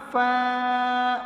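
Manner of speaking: فا with a long, held aa; drawn-out vowel. Shown as a demonstration. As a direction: stretch the aa of فا steadily. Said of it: "پورا پہلے کھینچو آرام سے". (فا کو کھینچ کر)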